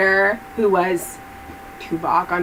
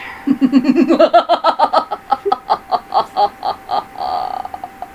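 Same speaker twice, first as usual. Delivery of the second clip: long high maniacal laugh; maniacal laughter; 6 semitones higher than usual. Acted. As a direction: laugh like a madwoman. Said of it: "I can't cackle like a witch".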